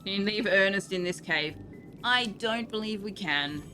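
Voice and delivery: ordinary talk, nothing marked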